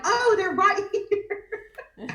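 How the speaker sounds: high, drawn-out answer dissolving into laughter; laughing retold realisation. Group answering the card-party guest, laughing imitation. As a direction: stretch it out through a laugh.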